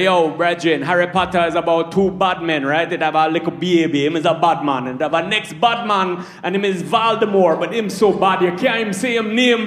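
storytelling in a Jamaican patois; Jamaican accent. Retold or imitated. Comic retelling Harry Potter to friends, in a Jamaican accent. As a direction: tell it like a Jamaican yardman. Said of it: "in a Jamaican accent".

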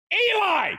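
urgent calling out across a stage. Retold or imitated. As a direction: shout his name to bring him back. Calling after player on live TV.

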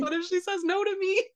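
high-pitched anxious squeak of a question; mock panicked worry. Son retelling his wait for mother's reply, high mock-worried fretting. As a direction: fret in a high, small voice.

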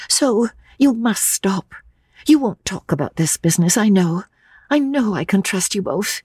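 put-on high, stammering young woman; nervous girl tripping over her words; young Lucy, flustered. A narrator's reading. Lucy protesting to old Mr Emerson, flustered and high.

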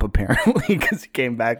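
talking through laughter; amused, words breaking into a laugh. Real, not acted. Telling friends about kid's long bathroom trip, laughing.

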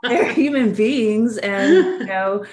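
laughing. Real, not acted. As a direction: laugh it out while telling. Laughing at her own story.

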